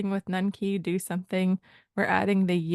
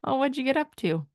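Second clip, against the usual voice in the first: higher-pitched, chatty question; informal, lilting up high then falling; casual; 7 semitones higher, faster than usual. Shown as a demonstration. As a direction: ask casually what they've been doing. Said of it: "that's kinda how it sounds to me".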